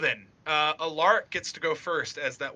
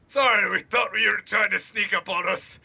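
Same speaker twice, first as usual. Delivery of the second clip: raised, shouted apology, climbing higher; panicked apology; 5 semitones higher, 6 dB louder than usual. Acted. Crossbowmen to the man they shot, panicked shouted apology. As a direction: plead loudly in mock panic.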